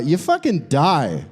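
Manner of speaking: ordinary talk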